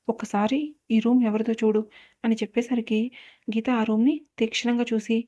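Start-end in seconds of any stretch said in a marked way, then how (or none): none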